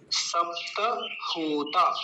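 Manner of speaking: सप्त with an audible ta, then long होता; full त. Shown as a demonstration. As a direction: separate सप्त होता, keeping the vowel on त. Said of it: "सप्त नहीं बोलेंगे". (सप्त में त पूरा)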